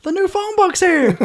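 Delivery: high, giddy shout of joy; The Jerk quote. Retold or imitated. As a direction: squeal it like Navin. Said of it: "call back to the jerk".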